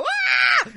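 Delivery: very high, loud mock scream; collective scream. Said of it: "todos alrededor de su familia gritaron".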